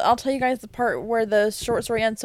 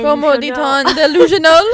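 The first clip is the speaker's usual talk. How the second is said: laughing through mock French; 6 semitones higher, 12 dB louder than usual. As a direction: giggle out the question.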